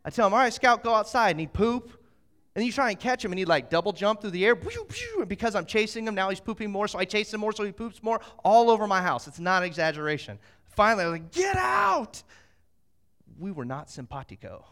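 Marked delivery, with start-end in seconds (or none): 0.3-1.3 loud, raised-pitch order to a dog; retold dog command. Dog-sitter sending Scout outside, retold loud command. 11.3-12.2 high, loud yell of banishment; exasperated shout. Retold or imitated. Fed-up dog-sitter throwing the dog out, exasperated shout.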